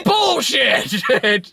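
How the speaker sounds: high, drawn-out mock yell; heckler's shout of disbelief. Yelling out at the movie in the theater, mock shout. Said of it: "Yell out".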